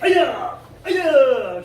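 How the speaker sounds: very loud piercing high yell; kiai shout. Shown as a demonstration. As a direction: explode the yell on the break. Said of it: "Break it".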